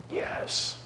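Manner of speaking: breathy whispered yes, barely voiced; a coach's silent inner cheer, whispered. Coach cheering his runner on, whispered yes.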